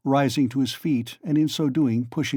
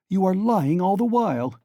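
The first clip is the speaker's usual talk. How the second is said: raised accusing cry; cried out; 6 semitones higher than usual. A narrator's reading. Raskolnikov crying out at Porfiri, accusing. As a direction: cry it out at him, higher. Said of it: "he cried".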